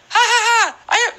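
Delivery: very high, loud squeaky laughing taunt; mock a cartoon princess with a high-pitched cackle; Princess Peach. Streamer imitating the princess taunting her blue-shell victim, very high-pitched. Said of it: "it's really high-pitched".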